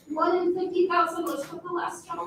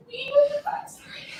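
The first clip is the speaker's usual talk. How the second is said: ordinary talk, nothing marked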